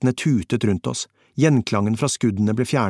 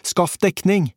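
high, urgent shouted command; pitched-up battle yell; screamed order; 9 semitones higher, slower than usual. A narrator's reading. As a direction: cry out an order under fire. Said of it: "skrek jeg".

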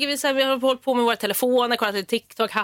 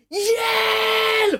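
long, high-pitched cry for help; drawn-out, high imitation scream; 13 semitones higher than usual. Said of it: "hör jag någon som skriker".

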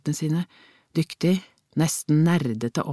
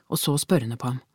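ordinary delivery, nothing marked

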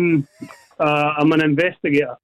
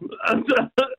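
teary laugh; 7 semitones higher than usual. Real, not acted. Winner overwhelmed by win.

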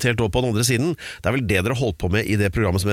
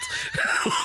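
high burst of laughter; 6 dB quieter than usual. Real, not acted. Laughing at the story.

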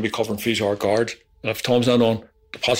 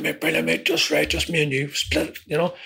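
higher, lightly put-on cheeky mate; cheeky Ronnie; 5 semitones higher than usual. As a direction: play the chuffed partner, pitched up. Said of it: "He's going".

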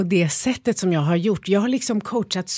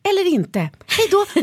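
awkward retreat; 12 semitones higher, 4 dB louder, slower than usual. Retold or imitated. Awkward exit after the silence.

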